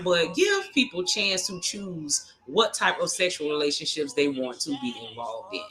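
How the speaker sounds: ordinary delivery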